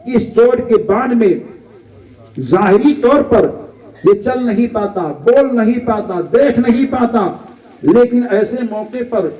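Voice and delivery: ordinary delivery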